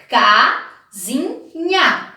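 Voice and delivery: Casinha stretched very slowly, syllable by syllable. Shown as a demonstration. Teacher showing children how to split Casinha, very slow. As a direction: slowly open the mouth on each syllable of Casinha. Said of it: "Cada vez que você abre a boquinha".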